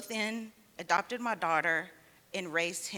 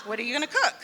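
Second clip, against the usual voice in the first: louder, half-laughing mock panic; laughing alarm; 4 semitones higher, 8 dB louder, much faster than usual. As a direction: react with amused dread at a kid cooking. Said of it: "'cause I was like".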